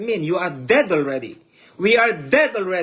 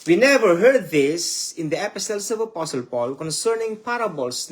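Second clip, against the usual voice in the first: ordinary talk, nothing marked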